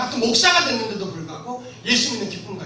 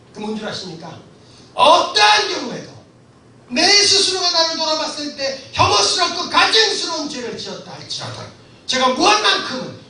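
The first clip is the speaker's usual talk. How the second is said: ordinary talk, nothing marked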